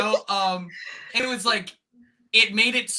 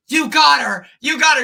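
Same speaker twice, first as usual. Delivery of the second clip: raised, high mock crowd yell; excited retold cheering; 8 semitones higher, 9 dB louder than usual. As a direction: shout it out like excited onlookers. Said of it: "as they're like".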